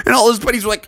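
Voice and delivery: plain reading voice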